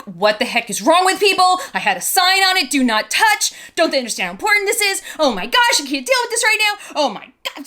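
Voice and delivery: high-pitched retold rant; retold yelling. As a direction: re-enact losing it, voice climbing high. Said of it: "and I was yelling".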